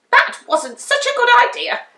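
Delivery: high witch voice, rueful; admitting the spell went wrong. A narrator's reading. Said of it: "said Winnie".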